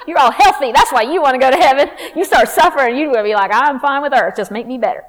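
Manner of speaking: fired-up preacher; preaching at full force. Acted. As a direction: drive the point home to the congregation.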